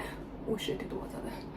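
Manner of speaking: breathless whisper of alarm; Oh shit whispered in sudden fear; whispered panic. Retold or imitated. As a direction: whisper it as a frightened thought. Panicked inner thought mid-attack.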